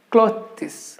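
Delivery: clothes split into two syllables, klo-thiz; clothes with an added vowel, cloth-es; wrong pronunciation. Shown as a demonstration. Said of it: "mistake pronouncing it as".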